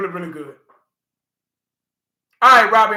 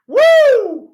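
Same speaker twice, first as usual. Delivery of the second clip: a high, loud whoop of delight; excited whoop; 12 dB louder than usual. Real, not acted. Laughing at his own Tyson talk, a loud happy whoop. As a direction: let out a big celebratory woo. Cheering after the Tyson boast.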